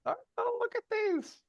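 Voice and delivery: very high, soft coo. Real, not acted. Father shows off his baby's cute cheeks, high baby-talk cooing.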